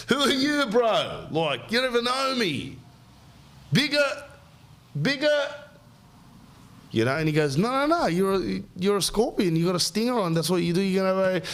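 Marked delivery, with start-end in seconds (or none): 0.1-5.5 high, indignant scorpion voice; offended scorpion. Acted. Scorpion protesting to the frog, high and offended. 7.6-11.5 frog explaining. Acted. Frog voiced explaining, higher.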